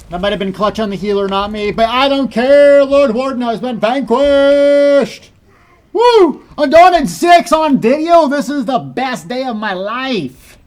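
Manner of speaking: high, loud elated cheering; victory shouting. Real, not acted. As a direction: celebrate at full volume. Victory after boss dies.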